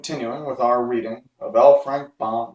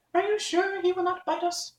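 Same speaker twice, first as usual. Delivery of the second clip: tiny, much higher, nervous squeak; timid voice. Acted. Mouse queen timidly asks the Woodman about the dog, high squeak. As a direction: ask it timidly, peeking out. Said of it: "asked in a timid voice".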